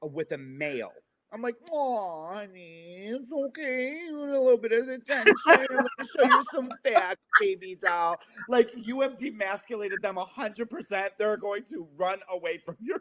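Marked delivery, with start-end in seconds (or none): 1.7-8.2 a hint of high, nasal, patronising aunt; lightly voiced condescending nasal coo. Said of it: "when I use that voice".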